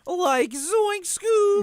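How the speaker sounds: very high, slow Shaggy voice; Shaggy impression. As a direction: squeak it like Shaggy. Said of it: "He does Shaggy".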